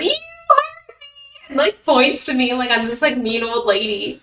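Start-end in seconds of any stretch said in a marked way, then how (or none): 0.0-1.0 imitated child's scream. Little girl hit by falling pack, mock screaming. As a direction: mimic a little girl's slow, squealed accusation. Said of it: "she screamed, and she goes".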